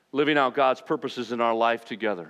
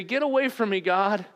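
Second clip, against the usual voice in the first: much higher, pleading cry; overwhelmed plea; 11 semitones higher than usual. Acted. Peter on his knees before Jesus: high, overwhelmed plea. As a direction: beg him to go, overwhelmed.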